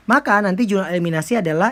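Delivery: usual speaking voice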